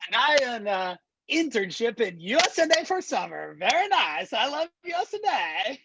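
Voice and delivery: put-on high, bouncy Borat accent; broken English Borat accent. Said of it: "do the entire demo in Borat voice".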